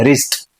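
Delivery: રિસ્ટ starting straight on r; silent W. Shown as a demonstration. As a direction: drop the W, say રિસ્ટ as "rist". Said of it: "આને રિસ્ટ બોલાય". (રિસ્ટમાં 'વ' વગર, 'ર'થી શરૂ)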